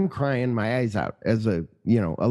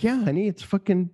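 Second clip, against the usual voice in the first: much higher, earnest protest; higher pleading to wife; mock defensive plea; 8 semitones higher than usual. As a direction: insist to the wife it's powerful. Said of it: "And I'm like".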